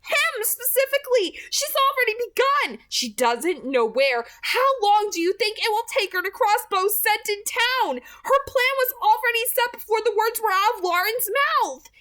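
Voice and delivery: high, loud, racing warning; frantic plea to make him see; urgent alarm. Acted. Edith urgently warning Archie.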